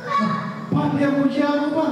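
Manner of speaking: high, breathy excited gasp; delighted surprise. Acted. Boy tells dad, amazed the echo praised him. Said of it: "Kaget dia".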